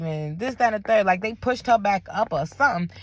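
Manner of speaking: ordinary delivery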